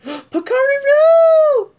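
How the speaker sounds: gasp, then a very high, slow shout; excited shriek. Real, not acted. Spotting a favourite fursuiter, delighted shriek.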